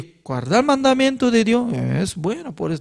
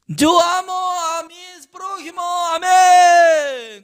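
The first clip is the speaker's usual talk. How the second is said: mock pious declaration; 9 semitones higher, slower than usual. Preacher parodies a believer boasting he loves his neighbours, high mock shout. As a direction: parody a smug believer proclaiming his love.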